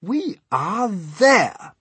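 high, loud, drawn-out announcement; stretched-out cheery cry; trying to be cheerful. A narrator's reading. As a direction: proclaim it slowly as the old grasshopper. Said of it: "trying to be cheerful".

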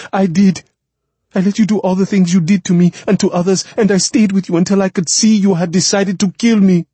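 high, loud outburst, words rushing out; impassioned accusation. Acted. Woman accusing the man who decided to kill her, indignant. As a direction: fire back, voice soaring with indignation. Anyanwu protests his disbelief, accusing him.

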